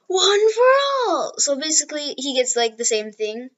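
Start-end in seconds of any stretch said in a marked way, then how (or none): 0.1-1.3 high, forceful yell of the move name; high, straining power-up cry; battle shout. Retold or imitated. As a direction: shout the attack with everything you've got. Deku unleashes his quirk.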